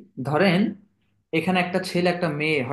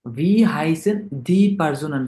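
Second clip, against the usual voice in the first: slow careful reading; 4 dB louder, much slower than usual. Shown as a demonstration. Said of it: "teacher reads German question slowly".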